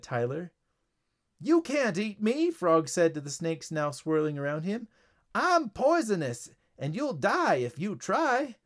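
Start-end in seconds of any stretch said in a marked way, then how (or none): 1.4-2.5 high-pitched panicky protest; cornered frog villain protesting; alarmed frog. A narrator's reading. 5.3-8.6 high, drawn-out protest; cornered frog, slow and high; desperate frog warning. A narrator's reading. Frog warns attacking snakes.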